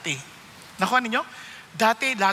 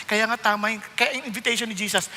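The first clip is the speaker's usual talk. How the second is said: usual speaking voice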